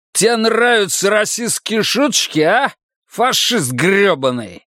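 high-pitched, indignant accusation; angry challenge. A narrator's reading. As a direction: confront him, then drag out the insult. Acted quarrel over a racist joke.

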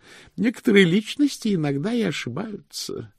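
high-voiced, squeamish concession; disdainful high-pitched clerk; fastidious distaste. A narrator's reading. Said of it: "согласился Бент брезгливо".

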